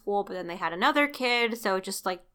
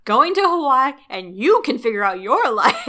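loud, higher, hammed-up walkout speech; mock-dramatic wife leaving her husband; theatrical ultimatum; 5 semitones higher, 7 dB louder than usual. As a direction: declare it grandly, breaking into a laugh. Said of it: "yelling at your husband in a fantasy".

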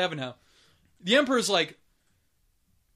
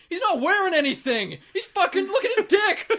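high, loud mock-child outburst; little kid piping up; 11 semitones higher, 5 dB louder than usual. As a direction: imitate a kid blurting it out, on the edge of laughter. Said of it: "the one little kid pipes up and goes".

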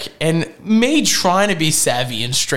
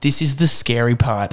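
ordinary talk

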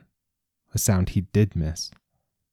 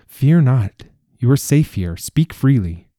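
reassuring; 5 semitones higher, 4 dB louder than usual. A narrator's reading. King reassuring frightened Brahm.

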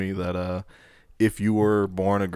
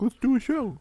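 high mock voice. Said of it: "you were like".

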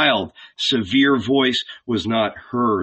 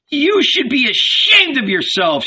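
much higher, louder berating; scolding shout; 13 semitones higher, 4 dB louder than usual. A narrator's reading. Old Salome berates her husband, shouting. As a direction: shame him, shouting. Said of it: "she shouted at him".

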